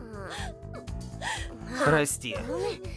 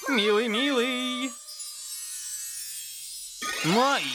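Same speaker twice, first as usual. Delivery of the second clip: cooing; 4 semitones higher, slower than usual. Acted. Cooing over cute girl.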